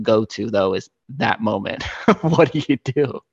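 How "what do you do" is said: laughing through quote. Real, not acted. Laughing retelling favourite moment.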